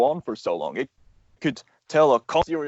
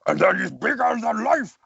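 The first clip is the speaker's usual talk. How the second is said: much higher, larger-than-life Heavy voice; Heavy impression; 11 semitones higher than usual. Shown as a demonstration. Explaining how to voice Heavy, a big character voice. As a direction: throw the Team Fortress Heavy into it. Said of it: "So he kind of talks".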